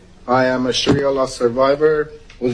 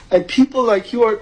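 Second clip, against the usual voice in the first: choked up, fighting tears; crying; 6 semitones higher than usual. Real, not acted. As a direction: voice breaking with tears. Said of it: "but I'm, like, crying".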